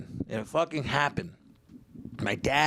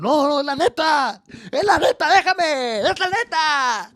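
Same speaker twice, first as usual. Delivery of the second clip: worked-up yelling; 10 dB louder than usual. Retold or imitated. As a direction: shout back while being held off. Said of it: "fucking snapped".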